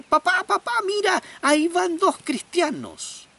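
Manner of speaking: put-on high, loud excited child calling out. Boy excitedly calls his father to look. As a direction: point it out eagerly like a small boy.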